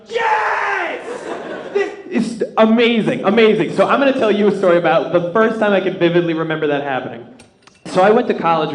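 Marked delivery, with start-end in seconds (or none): 0.1-3.7 inner joy shout. Retold or imitated. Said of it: "inside you're like".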